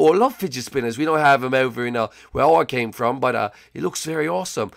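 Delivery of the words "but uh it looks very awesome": ordinary talk, nothing marked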